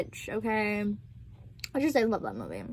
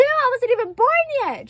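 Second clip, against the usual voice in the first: high-pitched incredulous cry; shocked disbelief; 9 semitones higher than usual. Real, not acted. Realizing the movie predates own birth, shocked. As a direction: exclaim it high, stunned by the fact. Shocked take of same words.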